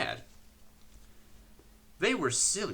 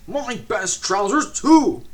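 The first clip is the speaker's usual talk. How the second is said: loud, higher, slow outburst; outraged; 10 semitones higher, 11 dB louder, slower than usual. A narrator's reading. Controller rages to Percy about his trousers, loud outrage. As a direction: rage over ruined trousers.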